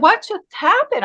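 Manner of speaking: high-pitched retold bewilderment; dazed and confused. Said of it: "dazed and confused".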